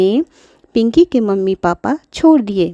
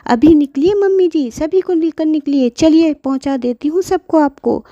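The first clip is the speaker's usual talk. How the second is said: a touch higher, bossy eviction; mother ordering out; 7 semitones higher than usual. Acted. Mother evicts grandmother, bossy higher voice. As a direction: push granny out impatiently. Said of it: "Pinky की mummy बोली".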